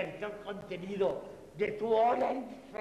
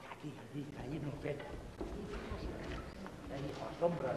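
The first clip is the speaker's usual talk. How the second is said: whispering; 8 semitones lower, 10 dB quieter, much faster than usual. Acted. Buscan escondite para el secreto.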